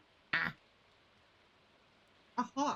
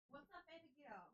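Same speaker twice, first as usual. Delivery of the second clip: high, soft cooing to the cat; 5 semitones higher, 26 dB quieter than usual. Real, not acted. Streamer coos softly to her meowing cat.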